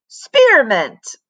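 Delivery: loud triumphant reveal; crying out. A narrator's reading. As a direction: announce it with a flourish. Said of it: "cried Mister Jupiter".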